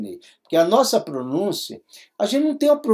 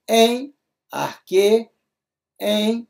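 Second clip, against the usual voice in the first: em, arque drawn out slowly; slow read-aloud; 4 semitones higher, much slower than usual. Shown as a demonstration. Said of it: "Então".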